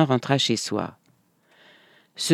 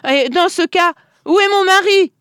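cri aigu et furieux de l'épouse; folle de rage; 5 dB louder than usual. A narrator's reading. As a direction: exige de savoir où est son mari. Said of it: "s'exclama madame Schmiel, folle de rage". (exclamation furieuse, voix très haute)

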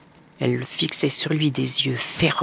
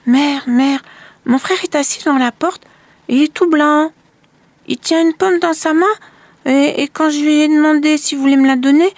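put-on high, loud child's voice, anxious; a small girl running to her mother, scared; Marlène telling her mother about her brother, high and worried; frightened little girl; 11 semitones higher, 6 dB louder than usual. A narrator's reading.

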